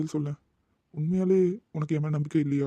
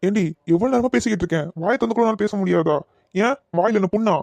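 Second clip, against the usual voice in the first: loud, raised, exasperated scolding; angry shout; 2 semitones higher, 6 dB louder than usual. Acted. Boyfriend yells at silent girlfriend in anger. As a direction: snap at her for not answering. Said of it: "கோவத்துல கத்திட்டேனே".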